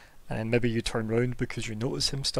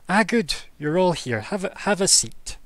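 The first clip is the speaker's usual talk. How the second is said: high bright welcoming voice; cheerful host voice; 6 semitones higher, 5 dB louder than usual. Acted. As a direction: welcome everyone warmly. GM voices Hilda greeting.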